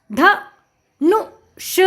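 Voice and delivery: ध, नु, ष as three separate sounds; sounding out. Shown as a demonstration. Teacher spelling out a word for children, sound by sound. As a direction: break it up with pauses: ध... नु... ष. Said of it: "उच्चारण करके पढ़ लिया".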